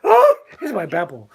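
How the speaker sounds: a hint of a happy toddler hearing Papa; granddaughter's delight. Grandfather imitates granddaughter hearing him on the phone, lightly voiced. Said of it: "she goes".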